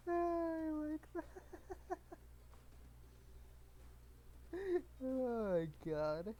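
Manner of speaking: high, drawn-out words through laughter; real helpless giggles, slowing the words; laughing hard. Real, not acted. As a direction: squeal it out while cracking up. Cracking up at own joke.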